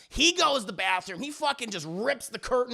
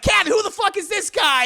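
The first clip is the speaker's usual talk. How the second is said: high, loud mock-furious shout; acted outrage; 11 semitones higher, 8 dB louder than usual. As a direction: shout it as the betrayed husband. Husband finds lover in closet.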